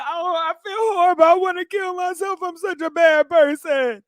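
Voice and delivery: high whiny mock-remorseful voice; mock crying abuser. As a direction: ham up a sorry man who hit her. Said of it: "and then cry about it and say".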